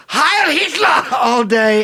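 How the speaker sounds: high, slow mock shout; retold shout. Retelling a boss's rants, high slow mock shout. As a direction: stretch out a mock salute cry. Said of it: "walked around saying".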